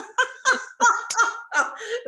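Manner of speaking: bright burst of laughter, high up; delighted squealing laugh. Real, not acted. Host laughs at her own joke.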